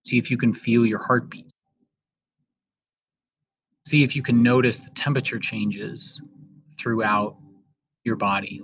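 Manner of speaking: slow meditation voice with long pauses; guided meditation. Acted. Host leading students through breathing, in a slow guided-meditation voice. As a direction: ease each instruction out slowly, leaving room to breathe.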